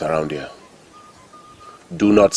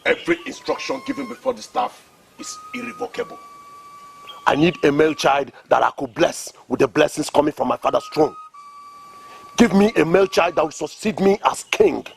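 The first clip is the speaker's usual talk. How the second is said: raised, high-pitched furious command; angry demand; 9 semitones higher than usual. Acted. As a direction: bark the demand from the throne. King demands a male heir.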